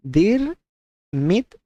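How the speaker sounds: Dir, then Mit, split apart, Dir high; syllable by syllable. Shown as a demonstration. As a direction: break it into Dir and Mit with a pause. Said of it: "It's typically pronounced".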